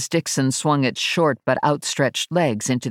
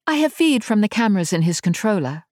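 higher-pitched Englishwoman's voice; English accent; 7 semitones higher, faster than usual. A narrator's reading. Ash introduces herself to Verity, English accent. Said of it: "a woman with an English accent".

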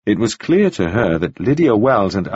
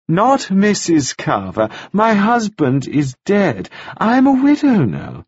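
slow, higher, over-clear correction; widow correcting; 9 semitones higher than usual. A narrator's reading. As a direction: spell it out to a foreigner. Mrs Wells corrects slowly.

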